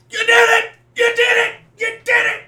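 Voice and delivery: very high, very loud triumphant yelling; repeated jubilant shouts; ecstatic shout. Retold or imitated. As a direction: scream it like you just won big. Dad bursts in celebrating.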